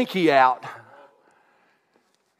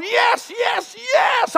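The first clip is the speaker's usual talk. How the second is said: joyful shouts of praise; much slower than usual. Retold or imitated. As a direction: burst out praising God. Praising God in the bedroom.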